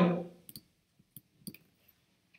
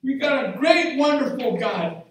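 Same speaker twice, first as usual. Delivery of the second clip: high, loud ecstatic praise; rejoicing preacher, whooping; preaching at full force; 7 semitones higher, 9 dB louder than usual. Real, not acted. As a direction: shout the church into joy.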